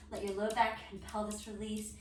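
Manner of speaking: ordinary talk, nothing marked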